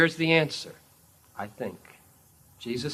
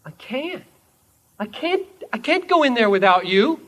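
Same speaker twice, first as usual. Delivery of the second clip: higher-pitched, incredulous protest; disbelief; 10 semitones higher, 11 dB louder than usual. Acted. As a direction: act out a disbelieving plea. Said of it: "look up at him in disbelief and say".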